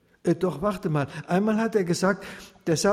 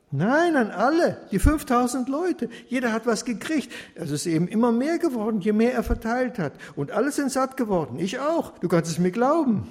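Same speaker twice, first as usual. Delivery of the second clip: higher, louder eager telling; bursting to share the miracle; boy excitedly telling his friend; 8 semitones higher, 3 dB louder than usual. A narrator's reading. Baruch excitedly tells the miracle.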